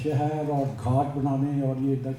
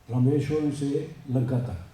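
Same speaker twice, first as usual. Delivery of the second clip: normal talking voice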